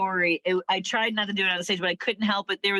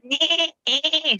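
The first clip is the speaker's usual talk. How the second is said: higher nasal-free goat bleat; 5 semitones higher, slower than usual. Shown as a demonstration. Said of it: "See the difference?".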